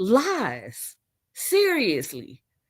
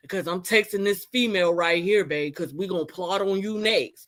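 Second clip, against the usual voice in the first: lower, mocking sweet boyfriend voice; 6 semitones lower than usual. Mocking how her man should report to her, low and syrupy. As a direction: put on a low, syrupy babe voice. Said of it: "he was supposed to tell you".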